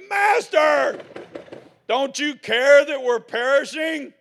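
high, loud, desperate cry for help; panicked shout. Retold or imitated. Disciples screaming to wake sleeping Jesus, panicked shout. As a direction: yell it like the boat is sinking.

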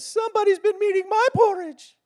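high put-on mama bear voice, loud; squeaky-high bear mother. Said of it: "Mama bear says".

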